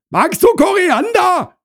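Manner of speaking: very high, loud holler; calling out. Acted. Wife asks husband inside about coriander, shouting high. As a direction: yell the question through the flat.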